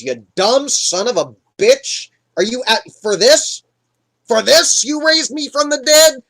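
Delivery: indignant, rising to high, loud disbelief; berate, building in outrage; irate dead prophet. Retold or imitated. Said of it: "he's not happy".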